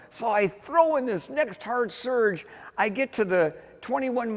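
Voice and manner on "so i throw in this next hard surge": usual speaking voice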